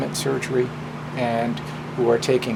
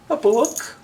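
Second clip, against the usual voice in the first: much higher, cheerful little voice; innocent Book; 10 semitones higher than usual. Acted. Book cheerfully telling Match about school, high and innocent. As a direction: chirp it out, unaware of trouble.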